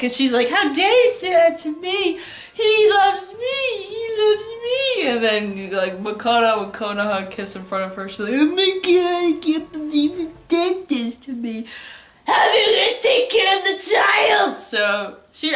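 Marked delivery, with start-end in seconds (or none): 0.5-5.1 very high, drawn-out melodramatic whine; mock heartbroken wail. Rival insisting that he loves her, mock wailing. 8.3-14.6 high hammed-up wail rising to a shout; mock tearful outburst. Betrayed girl watching them kiss, mock tearful outburst. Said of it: "runs out crying".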